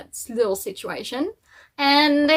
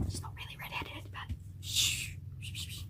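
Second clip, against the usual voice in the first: hushed, unvoiced confiding aside; secretive whisper; 17 dB quieter than usual. Vlogger lets viewers in on a secret, playful whisper. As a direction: whisper it like a secret, then shush.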